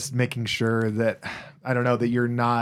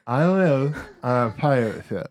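tongue held, words slurred; holding tongue with fingers. Shown as a demonstration. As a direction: speak with fingers on your tongue. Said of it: "Should I do it on air?".

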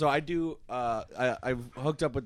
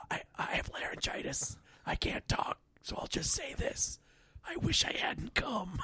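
raspy whisper; 12 semitones higher, 5 dB quieter than usual. Retold or imitated. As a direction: whisper it hoarsely, grumbling. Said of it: "a really raspy voice like this".

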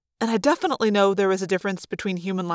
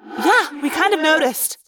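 sarcastic; 7 semitones higher than usual. Acted. Rachel sarcastic reply.

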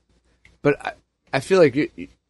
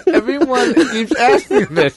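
high, slow laughing protest; 10 semitones higher, 5 dB louder, slower than usual. Real, not acted. Amused at being called drunk.

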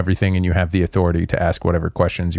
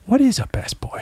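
much higher, mock baffled outsider; puzzled outsider; 4 dB quieter than usual. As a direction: pitch it way up like a baffled stranger. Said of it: "they're like".

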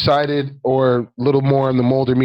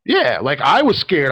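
ordinary talk, nothing marked

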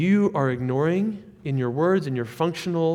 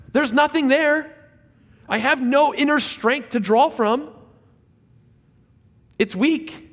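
high, loud, pleading sermon peak; climbing preacher's cry; impassioned preaching; 7 semitones higher, 4 dB louder than usual. Real, not acted. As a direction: preach it at full force, voice rising. Preaching climax on weakness.

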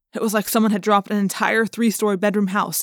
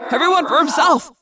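high, loud panicked cry; yelled; 7 semitones higher, 4 dB louder than usual. Acted. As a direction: shout it to scatter the group. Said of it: "I yelled".

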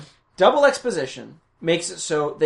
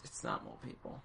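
whispered, much lower and quieter; whispered mock dismissal; 23 dB quieter than usual. Friend mimicking everyone's dismissal in a low whisper. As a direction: breathe it out dismissively. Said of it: "everybody's like".

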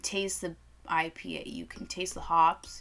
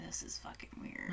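barely voiced, much softer aside; creeped-out whisper; 17 dB quieter than usual. Telling her friend in the store it's weird, whispered. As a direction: breathe it to your friend so nobody hears.

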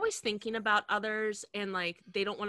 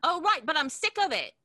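Cockney mum; 5 semitones higher, 3 dB louder than usual. Acted. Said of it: "that's my husband".

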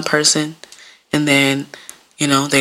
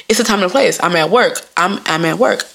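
normal talking voice

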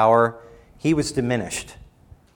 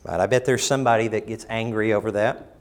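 usual speaking voice

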